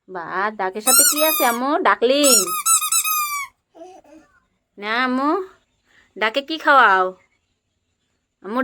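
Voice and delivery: put-on high, bright play voice for a small child; playful toddler-talk. Mother playing house with her toddler, high coaxing voice. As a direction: coax the little one along in pretend play. Feeding toy duck with toddler.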